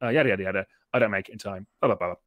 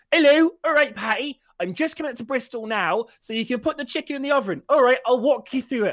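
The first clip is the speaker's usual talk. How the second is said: hohe, laute Stimme einer Mitreisenden am Handy; quäke hoch und laut ins Telefon wie eine Mitreisende; Frau am Telefon; 13 semitones higher, 5 dB louder than usual. Retold or imitated. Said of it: "the woman in front of us goes".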